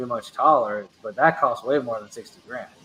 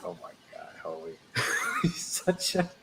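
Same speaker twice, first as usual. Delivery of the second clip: laughing whisper; 6 semitones higher, 9 dB quieter than usual. Real, not acted. Laughing breathless aside.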